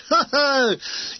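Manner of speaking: acted mocking guffaw. A narrator's reading.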